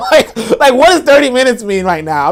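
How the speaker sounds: can't get the words out for laughing. Real, not acted. Host laughing at a follower's thirty-minute claim, giggling.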